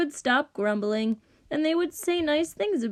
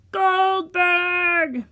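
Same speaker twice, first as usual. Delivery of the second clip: long, high, loud shout; bellowed name; 7 semitones higher, 5 dB louder than usual. A narrator's reading. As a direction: holler for the musician from bed. Rich dude wakes everyone again.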